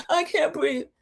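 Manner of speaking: high, put-on breathless whine; mock hyperventilating. Recapper mimics Margot hyperventilating, mock panic. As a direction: imitate faked panic in a high voice. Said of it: "She's hyperventilating".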